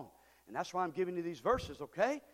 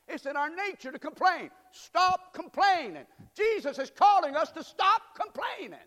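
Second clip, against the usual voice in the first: preaching at full force; 11 semitones higher, 8 dB louder than usual. Acted. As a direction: drive the command home. Preacher exhorting.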